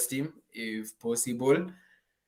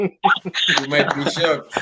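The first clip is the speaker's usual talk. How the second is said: slow, louder, through laughter; amused warning; 6 dB louder, much slower than usual. Real, not acted. Host answering a fan's bold Tottenham prediction, laughing. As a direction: laugh while drawing the words out.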